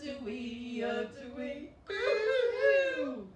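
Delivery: playful fake sobbing song; mock crying song. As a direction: pretend to cry for the children. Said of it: "I'm very sad".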